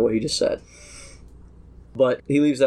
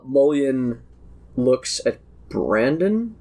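ordinary delivery

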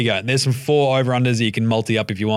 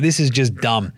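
ordinary talk

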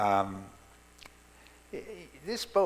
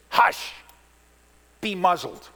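loud, sharp and much louder; stern command; 5 semitones higher, 10 dB louder than usual. Shown as a demonstration. Jesus commanding the storm, loud and stern. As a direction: bark it with full authority. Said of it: "it's a stern command of authority".

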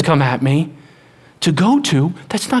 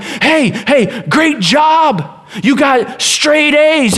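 cheering praise; 9 semitones higher, much slower than usual. Retold or imitated. Voicing praise for performance.

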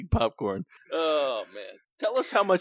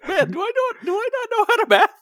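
much higher, fast self-mocking whine; 7 dB louder than usual. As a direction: squeak it out, laughing at yourself. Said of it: "Well, I was like".

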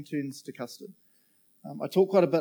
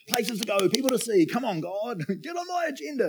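rushed busy self; 9 semitones higher, 3 dB louder than usual. Retold or imitated. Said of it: "I'm a, like".

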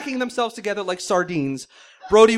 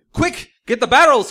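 higher, much louder urgent shout; 6 semitones higher, 11 dB louder than usual. Acted. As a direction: yell the order across the deck. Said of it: "Quint shouted to Brody".

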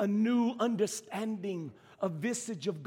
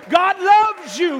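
high, loud burst on LOVES; loud, emphatic declaration; 12 semitones higher, 15 dB louder, slower than usual. Real, not acted. Preacher rubbing in that God loves you, loud and emphatic. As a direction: drive it home at full voice.

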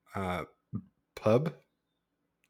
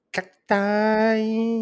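high squeak; 13 semitones higher, 5 dB louder than usual. Real, not acted. Drink name punchline.

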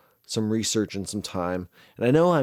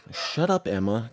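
scolding the dog. Real, not acted. Scolding barking dog mid-recording.

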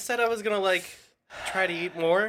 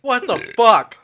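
sudden shocked outburst; shocked swearing; 6 semitones higher, 7 dB louder than usual. Real, not acted. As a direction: blurt it out in shock. Bites into a very hot pepper.

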